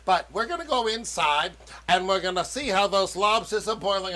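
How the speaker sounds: ordinary delivery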